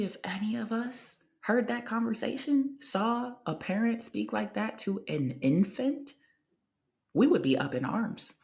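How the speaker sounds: ordinary delivery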